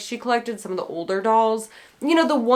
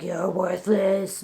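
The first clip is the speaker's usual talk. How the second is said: gravelly voice; 2 semitones lower, 3 dB quieter, slower than usual. Retold or imitated. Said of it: "it was like this gravelly".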